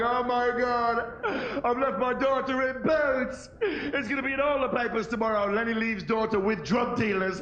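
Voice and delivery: hammed-up horror at losing the kid; mock panic. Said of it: "I was like".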